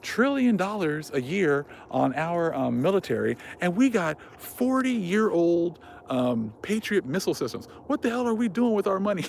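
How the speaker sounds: high, outraged mock rant, slowing on key words; imitating an angry prepper, pitched high; pissed-off prepper. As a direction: squeal the disbelief at the spending. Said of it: "He goes".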